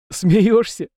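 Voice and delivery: a loud, high scoff; mock the very idea; loud incredulous scoff at the offer; scoffing snort. A narrator's reading. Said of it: "фыркнул Беломир".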